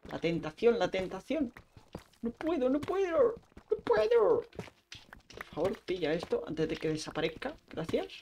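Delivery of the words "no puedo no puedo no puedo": high, whiny, hammed-up "I can't"; mock anguish. Gamer hamming up giving in to temptation, high and whiny. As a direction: play at being helpless before temptation. Can't resist mining more iron.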